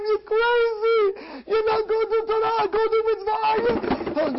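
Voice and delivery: high, loud crazed ecstasy; shouted, high-pitched frantic urging; frenzied raving. Acted. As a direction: rave like a man gone wild with zeal. Acts out someone crazed by Paradise.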